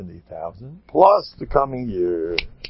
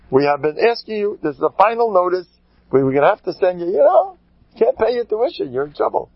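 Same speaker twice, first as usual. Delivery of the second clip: mock school office; 6 semitones higher than usual. School's final notice.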